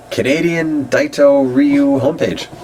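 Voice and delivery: high-pitched title reading; mock announcement. Reading a homepage title aloud, high mock announcement.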